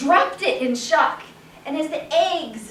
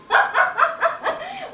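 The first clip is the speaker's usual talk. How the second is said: high laugh; 13 semitones higher than usual. Acted. Whole town laughing at itself, helpless high laughter. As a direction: double over laughing. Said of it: "was laughing, bent over double".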